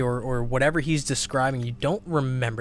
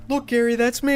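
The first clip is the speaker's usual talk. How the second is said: high-pitched, louder gleeful exclamation; excited TV sighting; 11 semitones higher, 5 dB louder than usual. Pretend. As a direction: point yourself out on TV, thrilled. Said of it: "you're like".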